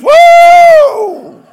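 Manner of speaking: high, loud whoop; excited shout. Retold or imitated. Congregation answering the preacher with a loud high whoop. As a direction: let out a joyful church whoop.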